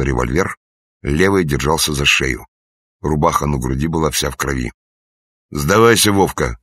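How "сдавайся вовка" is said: loud commanding shout. A narrator's reading. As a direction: shout the order to surrender. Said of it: "крикнул Лыков".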